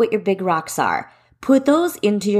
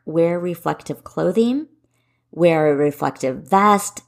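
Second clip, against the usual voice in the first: ordinary talk, nothing marked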